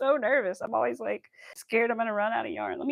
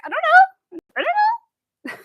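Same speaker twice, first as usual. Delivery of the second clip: mock clueless; 10 dB louder than usual. Wife acts out her reply to husband, mock clueless.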